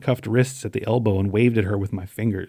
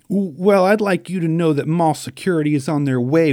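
higher-pitched, flustered indignation; stammering rage; 6 semitones higher, 3 dB louder than usual. A narrator's reading. Enraged store employee warns shoplifter of mall security, stammering. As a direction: trip on the first word, then threaten. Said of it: "went scarlet with rage and began stammering".

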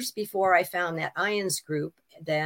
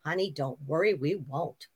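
dry quiet retort; 3 semitones lower, 7 dB quieter than usual. Retold or imitated. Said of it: "And my mother says".

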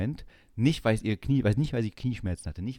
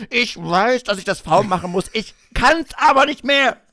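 high, loud, desperate cry of frustration; pitched-up overwhelmed protest; exasperated outburst; 13 semitones higher, 11 dB louder than usual. Retold or imitated. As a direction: wail it like you're at the end of your rope. Frustrated retold self on slope.

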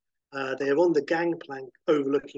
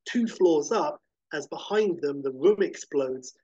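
usual speaking voice

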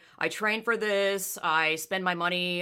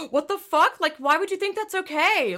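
outraged; 10 semitones higher, 5 dB louder than usual. Retold or imitated. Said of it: "and it was like".

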